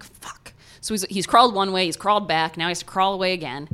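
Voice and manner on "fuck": whisper. Retold or imitated. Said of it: "now he's like".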